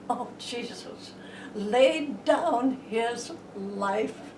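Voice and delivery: usual speaking voice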